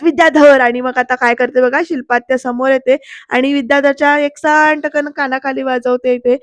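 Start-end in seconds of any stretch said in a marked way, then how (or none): 0.0-0.6 loud sharp yell of his name; loud shout. Retold or imitated. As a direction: scream his name to stop him. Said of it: "ओरडते जोरात".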